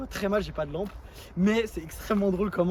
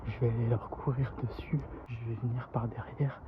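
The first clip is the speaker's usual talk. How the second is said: whispering; 7 semitones lower, 9 dB quieter than usual. Real, not acted. Sneaking up on friends filming.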